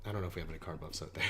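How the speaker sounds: low, quick, quiet aside; muttered aside. Real, not acted. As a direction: drop it low and rush through. Walks back the shout-out as aside.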